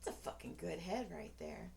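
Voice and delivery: low, soft admiring mutter; quiet aside. Acted. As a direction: murmur it low, as if to yourself. Murmurs remark, then says it aloud.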